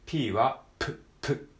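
プ as a sharp lip pop with no vowel; teacher showing the P; popped P. Shown as a demonstration. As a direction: spit out プ like a seed. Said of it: "唇をこう".

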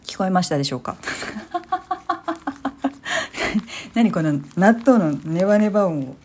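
ordinary delivery

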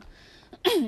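loud rough throat clear. Real, not acted. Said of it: "声ガラガラだな。".

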